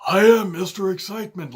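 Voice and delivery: loud, high-pitched mocking impression; exaggerated send-up of his voice; John Laurinaitis impression. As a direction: belt it out as a mock wrestling catchphrase. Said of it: "he always sounded like that".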